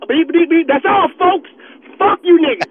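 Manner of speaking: loud, high mocking beeps; taunting shout. Real, not acted. Caller taunting Laker fans, loud mocking shout. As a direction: jeer at the rival fans.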